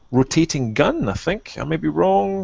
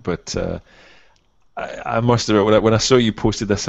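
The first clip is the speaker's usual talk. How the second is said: ordinary talk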